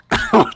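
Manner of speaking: loud, high burst of laughter; sudden loud laugh. Real, not acted. Laughs at mishap.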